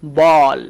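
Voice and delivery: "বল" loud, with a long "aw"; drawn-out demonstration of "বল" as "baaawl"; long vowel sound. Shown as a demonstration. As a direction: hold the "অ" in "বল" long and strong. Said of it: "long sound হবে এখানে".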